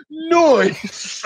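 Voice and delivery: high-pitched catchphrase outburst; re-enacted yell. Player starts his timed charades act, re-enacted yell. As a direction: yelp it out, acting out your own turn. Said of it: "why you'd yell out".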